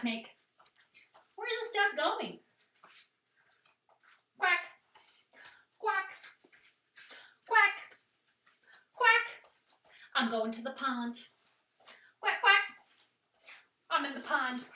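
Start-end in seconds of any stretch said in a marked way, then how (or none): 4.4-9.3 high loud quacking; duck quacks. Shown as a demonstration. Teacher quacking like a duck for kids. 12.2-12.6 high loud quacking; duck quacks. Shown as a demonstration. Teacher quacking like a duck for kids.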